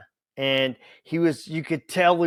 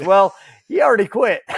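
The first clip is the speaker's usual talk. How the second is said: laughing punchline; 9 semitones higher, 8 dB louder than usual. Retold or imitated.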